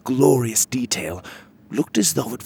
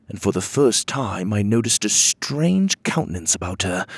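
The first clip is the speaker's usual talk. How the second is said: plain reading voice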